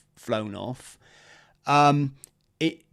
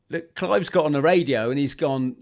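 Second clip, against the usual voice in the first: ordinary delivery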